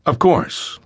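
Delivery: ordinary delivery, nothing marked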